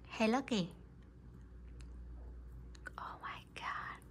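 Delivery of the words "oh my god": hushed whisper; breathy aside. Real, not acted. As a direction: whisper so she won't notice. Whisper at sulking cat.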